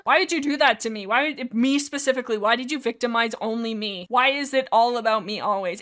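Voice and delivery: usual speaking voice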